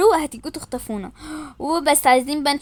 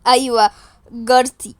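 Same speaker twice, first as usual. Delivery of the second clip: ordinary delivery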